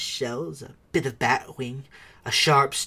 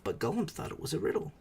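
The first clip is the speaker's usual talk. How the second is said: usual speaking voice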